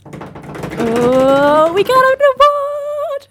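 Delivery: high, slow thrilled announcement; excited. Real, not acted. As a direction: squeal out the big news. Announcing award win.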